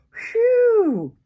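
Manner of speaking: high, loud gasp then a long phew; disgusted gasp. A narrator's reading. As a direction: recoil from the awful stink. Reacting to skunk spray smell.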